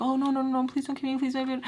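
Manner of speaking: high, quick mock begging; half-hearted imitation of begging for life; pretend pleading. Mock begging she can't do.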